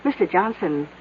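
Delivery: ordinary delivery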